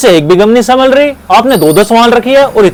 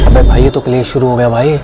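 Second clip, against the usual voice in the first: low aside; 12 semitones lower than usual. Acted. Shopkeeper aside, much lower.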